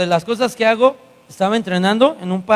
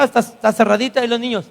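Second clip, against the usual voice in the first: normal talking voice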